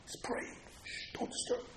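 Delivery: barely audible, protective whisper; hushed whisper. Acted. Householder shielding the man at prayer, whispering. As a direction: whisper it as if beside someone praying. Hushing soldiers near praying man.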